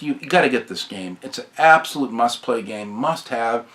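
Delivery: ordinary talk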